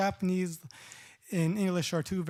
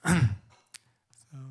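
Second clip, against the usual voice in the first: ordinary talk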